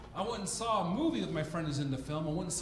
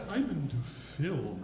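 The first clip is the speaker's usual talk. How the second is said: slow, lower snooty drawl; pretentious film guy; 3 semitones lower, slower than usual. Retold or imitated. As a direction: say it like a snob savoring the word. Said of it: "the guy's like".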